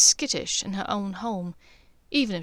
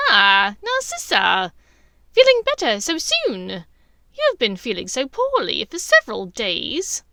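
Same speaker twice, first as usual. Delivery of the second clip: Bellatrix voice; 8 dB louder than usual. Acted. Bellatrix greets Narcissa.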